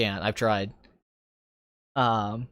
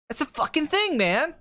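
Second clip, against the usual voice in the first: high-pitched ranting film director; sudden high, insistent burst; Quentin Tarantino; 8 semitones higher than usual. Shown as a demonstration. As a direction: rant it like an excitable movie geek. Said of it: "If I start ranting, I can get to that".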